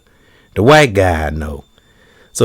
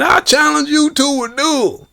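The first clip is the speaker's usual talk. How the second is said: much higher cartoon cowboy brag; Yosemite Sam. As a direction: crow each word like a cartoon gunslinger.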